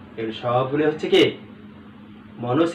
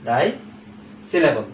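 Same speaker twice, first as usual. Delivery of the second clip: slow answer; much slower than usual. Shown as a demonstration. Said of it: "ফুটবলও কি?".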